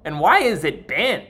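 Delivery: a touch of an irritated diner snapping; lightly voiced angry customer; mock rude customer. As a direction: throw the complaint at the waiter. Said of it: "she said".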